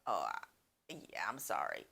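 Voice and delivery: low dopey mock apology; sheepish guy voice. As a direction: play the caught-out guy mumbling sorry. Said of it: "And he was like".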